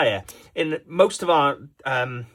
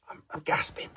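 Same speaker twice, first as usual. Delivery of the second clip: breathless, softer dry whisper of a thirsty player; parched whisper; 11 dB quieter than usual. Acted. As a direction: croak it out like you're dying of thirst. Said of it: "He's really thirsty".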